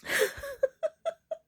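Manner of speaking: high-pitched laugh verging on sobs; tearful laugh. Real, not acted. Speaker moved by their own story, real tearful laughter. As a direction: let out a high, teary laugh. Emotional release after telling the story.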